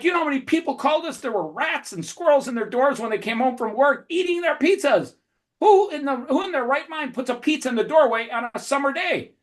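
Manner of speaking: high, fast ranting boss voice; hammed-up boss scolding a kid; imitated angry rant. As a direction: vent like an outraged pizza owner. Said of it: "he yells at me".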